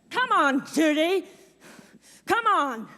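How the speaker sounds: high, loud impatient shout; urgent backstage holler; calling out. Acted. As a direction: yell for her to come on. Summoning Judy to the stage.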